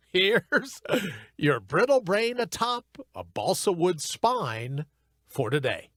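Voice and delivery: soft, slow dramatic show intro; hushed, slow mock-dramatic announcer read; segment announcer voice. Announces his recurring segment.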